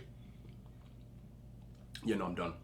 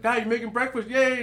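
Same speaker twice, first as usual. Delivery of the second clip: put-on high, excited child's cheer; happy little kid; 10 semitones higher, 3 dB louder than usual. Little kid cheering that dad is making breakfast, excited. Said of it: "you know, happy".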